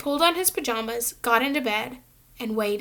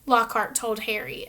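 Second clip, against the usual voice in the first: ordinary delivery, nothing marked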